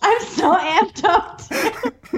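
high giggly laughing agreement. Real, not acted. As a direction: laugh through the words.

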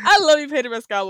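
fast, loud, laughing fake love shout; over-the-top teasing shout-out; loud mock declaration. Real, not acted. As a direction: gush at Pedro then get ready to roast him.

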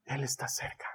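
unvoiced whisper. Shown as a demonstration. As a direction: whisper it softly.

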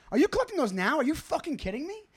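exasperated disbelief at the waiter; mock outrage. Comic retells snapping at a rude waiter, high and incredulous.

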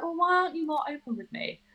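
a hint of a whiny mother; mocking mum. Mimicking mum's complaint.

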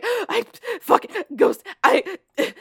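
high, loud, gasping mock panic; panicked stammer. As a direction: stammer it out as if just spooked by a ghost. Acting out terrified woman fleeing ghost.

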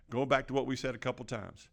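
ordinary delivery

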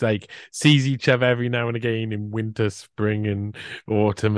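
ordinary talk, nothing marked

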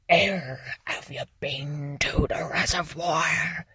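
soft, breathy whisper of the dog walker's question; whispered quote. Retold or imitated. A lady walking her dog asks about the reservoir, whispered. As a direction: whisper it like a secret tip. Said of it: "and she goes".

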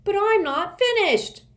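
put-on high, loud protesting child; crying out. A narrator's reading. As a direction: cry out in protest. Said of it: "one child cried".

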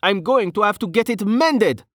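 high-pitched whiny grievance; complaining. A narrator's reading. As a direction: whine about it in a high voice. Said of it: "he complained".

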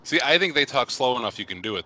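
ordinary talk

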